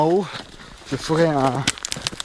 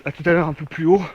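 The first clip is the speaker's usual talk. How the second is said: ordinary talk, nothing marked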